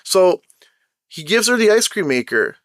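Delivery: usual speaking voice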